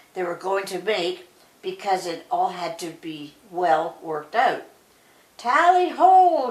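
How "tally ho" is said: slow, high, loud hunting call; hunting shout. A narrator's reading. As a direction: bellow it like a huntsman. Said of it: "shouted Claude Pole".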